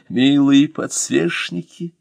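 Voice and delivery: usual speaking voice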